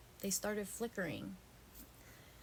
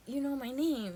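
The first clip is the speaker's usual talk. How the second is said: amazed realization; 6 semitones higher, 8 dB louder than usual. Retold or imitated. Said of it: "I was like".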